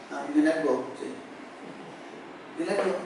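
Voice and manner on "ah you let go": usual speaking voice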